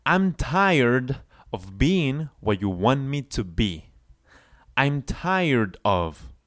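slow, careful lyric read; slow model line for students; slow teaching read. Shown as a demonstration. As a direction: spell it out slowly for learners. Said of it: "the singer saying".

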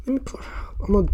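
quick whispered aside to herself; hurried whisper, almost unvoiced. Real, not acted. Whispering to herself while doing her makeup. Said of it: "unvoiced whisper aside".

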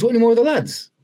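put-on high, delighted disbelief at seeing an old mate; surprised recognition. Same teammate, still surprised.

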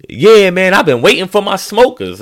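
high, loud eager dad voice; dad who can't wait for his smokers. Retold or imitated.

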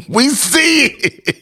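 high laughing repeat. Acted. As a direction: laugh it out, slowly, still mock hurt.

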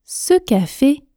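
slow, carefully articulated model sentence; tortoise speed. Shown as a demonstration. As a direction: say it slowly, every syllable distinct. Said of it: "la vitesse tortue, lentement, en articulant bien". (vitesse tortue, lentement et bien articulé)

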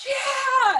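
very high, bright whoop; joyful cheer. Retold or imitated. Music director cheering her debut, a high whoop. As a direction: cheer like the music director just heard the news. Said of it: "And he was like".

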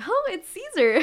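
high, laughing reply; bashful laughing answer. Retold or imitated. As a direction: giggle out the name. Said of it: "And I was like".

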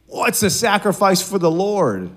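high, drawn-out self-justifying plea; imitating an indignant excuse-maker; mock protest. Saul protesting his excuse, retold.

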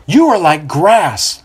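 booming prophet's proclamation; projecting voice. A narrator's reading. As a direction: project it over the crowd like a prophet. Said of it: "the baptizer projects".

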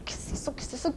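hushed, puzzled half-whisper; soft puzzled murmur. Retold or imitated. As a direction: mutter it to yourself, confused. Confused whisper at the nose thing.

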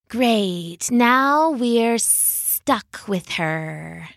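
slow, drawn-out snake voice; snide adder dragging the words; sneering adder. Acted. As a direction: let the snake sneer it out slowly.

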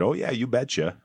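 mock Minnesota accent on a retold reply. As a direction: do a cheery "you betcha" Minnesota accent. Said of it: "in her best Minnesota accent".

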